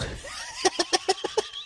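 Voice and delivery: burst of laughter. Real, not acted. Punchline laugh after the question.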